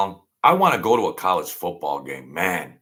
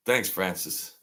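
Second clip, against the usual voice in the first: ordinary delivery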